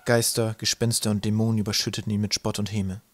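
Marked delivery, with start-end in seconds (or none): none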